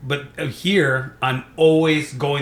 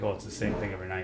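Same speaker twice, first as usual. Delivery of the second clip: bored mutter; 7 semitones lower, 12 dB quieter, faster than usual. Retold or imitated. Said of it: "because it's like".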